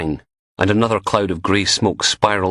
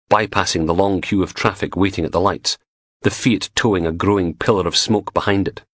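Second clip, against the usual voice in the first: ordinary delivery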